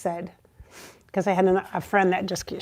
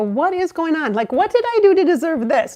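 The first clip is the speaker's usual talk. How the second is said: higher-pitched mild exasperation; exasperated questioning; 7 semitones higher, 4 dB louder than usual. Acted. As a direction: plead upward in disbelief. Acts out questioning the universe.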